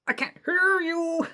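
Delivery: high cartoon captain voice. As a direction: tease the kids like the pirate captain. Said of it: "I heard".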